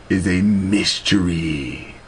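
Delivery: usual speaking voice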